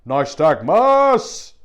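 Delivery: loud, slow roar of "Nystagmus!"; Viking battle cry. Pretend. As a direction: bellow "Nystagmus!" like a Viking warrior. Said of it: "It sounds like a Viking".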